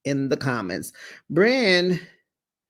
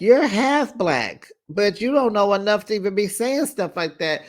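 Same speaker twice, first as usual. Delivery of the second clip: higher-pitched telling-off; mock scolding; 6 semitones higher than usual. Vlogger quoting one cast member correcting another, mock scolding. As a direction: lecture her in a raised pitch.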